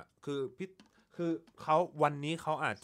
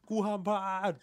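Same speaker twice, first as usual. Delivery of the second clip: pitched high and pushed louder, panicking; tense high outburst; 10 semitones higher, 6 dB louder than usual. Pretend. Barista imagining competition pressure, high tense outburst. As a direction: blurt it like the whole room is staring.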